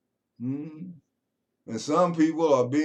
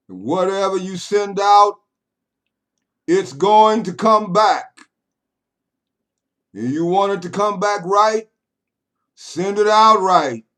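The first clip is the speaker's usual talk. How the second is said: higher and much louder preacher's cry; full-force preaching; 8 semitones higher, 10 dB louder than usual. Acted. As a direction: hammer it home like a pulpit climax.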